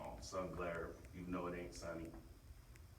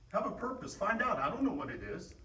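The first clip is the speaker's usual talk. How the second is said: loud, high, fired-up shouting; halftime pep speech; 14 dB louder than usual. Real, not acted. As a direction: fire up the team at full force.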